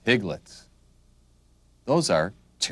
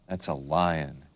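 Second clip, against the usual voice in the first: usual speaking voice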